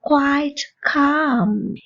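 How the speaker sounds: each word drawn out for learners to repeat; slow, clear teacher model for the class; very slow drill. Shown as a demonstration. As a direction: say it very slowly so the class can follow. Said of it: "என் கூட சேர்ந்து சொல்லி பாருங்க".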